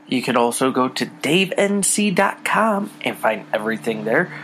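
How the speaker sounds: upbeat outro plug. Acted. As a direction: sell the socials like a radio promo.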